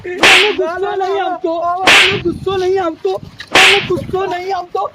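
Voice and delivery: loud angry denial, hammered again and again; furious shouting. Acted. Grandpa shouting back at the teasing boy, furious. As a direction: shout it, fuming, over and over.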